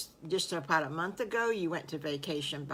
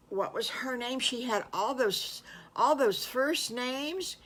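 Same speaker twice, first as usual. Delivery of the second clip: higher child voice, lightly put on; a hint of eager piglet; puzzled little piglet; 7 semitones higher than usual. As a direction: wonder aloud like a little piglet. Piglet trying to recall names.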